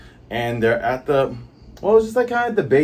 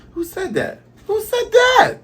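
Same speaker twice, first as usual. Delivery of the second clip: very high, squeaky startled cry, building louder; 4 dB louder than usual. Acted. Reader voices character startled by forest voice, high cry. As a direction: shriek it in mock alarm, rising to loud.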